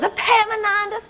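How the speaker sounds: a light touch of a high scolding mama; gently fussing at a foolish son; scolding mother. Acted.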